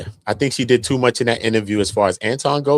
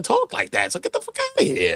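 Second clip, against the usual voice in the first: high exasperated outburst. Real, not acted. Dismissing her claim.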